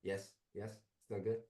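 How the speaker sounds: far from the mic; faint, off-mic voice; distant voice. Real, not acted. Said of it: "it sounds like you're distant".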